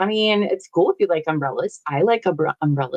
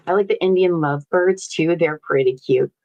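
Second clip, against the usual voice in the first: ordinary talk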